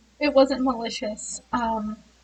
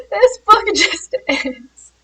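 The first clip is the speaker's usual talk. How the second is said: laughing; 8 semitones higher, 7 dB louder, slower than usual. Real, not acted. Laughing at the book ending.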